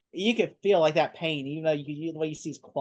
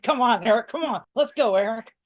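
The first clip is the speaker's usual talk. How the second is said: mock urging; 5 semitones higher, 5 dB louder than usual. Acting out Charles urging Eric, laughing.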